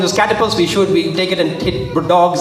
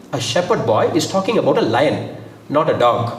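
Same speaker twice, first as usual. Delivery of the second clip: usual speaking voice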